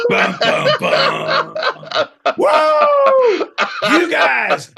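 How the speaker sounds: loud, high cheering whoop; triumphant shout. Real, not acted.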